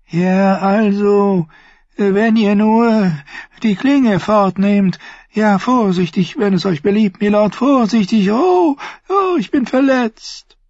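Varys gasps high and startled; gasped. Acted. Varys' hohe Stimme. (gekeucht, hoch und erschrocken)

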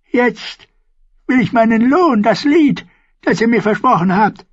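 put-on high, loud old woman's demand; high crone insisting on her song. A narrator's reading. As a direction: claim your reward like an old crone.

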